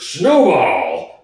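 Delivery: a hint of menace in the name; lightly voiced pig leader barking the name; terrible voice. Napoleon naming his enemy to the dogs, mildly terrible cry. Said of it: "exclaim in a terrible voice".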